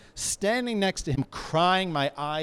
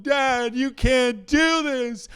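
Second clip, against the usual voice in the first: put-on high, loud, scolding child's cry; a small kid yelling to stop his drunk dad; 9 semitones higher, 7 dB louder than usual. A frightened kid yells at his peeing father, loud and high. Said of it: "I'm standing next to him going".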